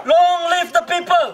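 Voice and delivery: high, loud rally chant; fiery revolutionary speech. Real, not acted. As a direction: chant it out to the crowd. Leads protest chant call.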